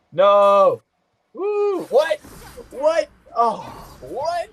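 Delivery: high, incredulous yelps; shocked outcry. Real, not acted. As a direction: cry out in disbelief, pitch shooting up. Killed in game, shocked outcry.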